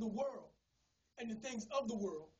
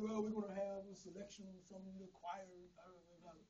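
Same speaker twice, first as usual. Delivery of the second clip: lightly voiced pastor, lower and softer; pastor's announcement; 6 semitones lower, 8 dB quieter than usual. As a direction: mumble a routine church announcement, trailing away to nothing.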